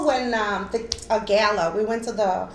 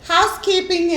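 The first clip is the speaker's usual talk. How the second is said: higher, louder singsong door call; housekeeping call; 8 semitones higher, 5 dB louder than usual. Retold or imitated. Prankster daughter knocks on hotel door, calling housekeeping.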